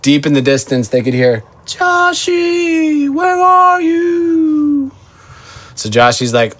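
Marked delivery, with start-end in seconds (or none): 1.6-4.9 high, slow distant call. Acted. Brothers calling from far off.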